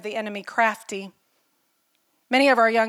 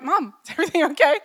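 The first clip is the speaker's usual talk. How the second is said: lightly voiced young boys asking, higher and quick; a hint of anxious kids checking on mom; worried sons; 6 semitones higher, faster than usual. Mother quotes her sons worried at her tears, lightly mimicked. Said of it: "They're like".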